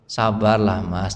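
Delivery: calm an angry husband softly; wife hushing her husband, tender and low; soothing wife. Acted. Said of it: "istri Bapak bilang apa?".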